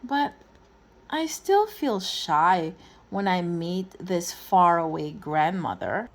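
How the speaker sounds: ordinary delivery, nothing marked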